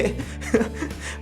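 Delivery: बिना आवाज़ की दबी हुई हंसी; सांस में छुपी हंसी; असली हंसी. Real, not acted. कहानी के अंत में हंसी. (सांस भरी दबी हंसी)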